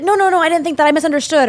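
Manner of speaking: acted scramble to take it back; flustered backtracking. Voices hypothetical flustered backtracking.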